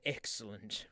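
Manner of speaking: low, soft, smug approval; deadpan villain. Acted. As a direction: purr it like a scheming villain. Sigh then deadpan over games.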